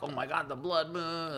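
high, hammed-up panicked cry; mock panic. Movie natives panicking at blood moon.

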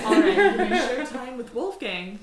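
laughing. Real, not acted. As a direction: give in while cracking up. Gives in while laughing.

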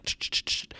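soft whispered machine whirr; whirring noise imitation. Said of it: "making this whirring noise".

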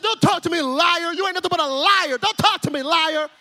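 hammed-up childish accusation; mock angry child. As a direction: play a child lightly snapping at dad. Acted child's angry outburst at father.

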